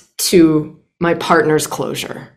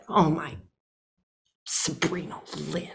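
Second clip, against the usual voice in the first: muttered self-scolding; 14 dB quieter than usual. Real, not acted. Scolding herself aside.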